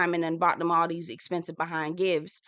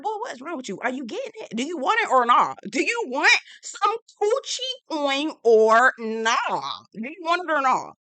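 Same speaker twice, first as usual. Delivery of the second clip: high, loud mock confrontation; raised, sassy scolding; exasperated scolding; 7 semitones higher, 4 dB louder than usual. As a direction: demand it in hammed-up exasperation.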